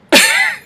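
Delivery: squealing laugh way up high; an abrupt shocked cackle; laughing at the plane exploding; high burst of laughter. Real, not acted.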